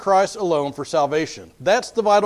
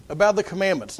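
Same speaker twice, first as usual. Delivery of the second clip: usual speaking voice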